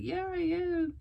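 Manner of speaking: high-pitched mock-tearful yes; retold tearful admission. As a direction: give in, imitating near tears. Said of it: "And I just start crying".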